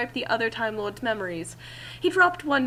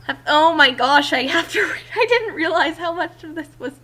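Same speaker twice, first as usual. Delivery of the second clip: real laughter through the words, louder; giggling disbelief at the script; laughing; 6 semitones higher, 9 dB louder than usual. Real, not acted. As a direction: crack up mid-sentence. Reader cracking up at text.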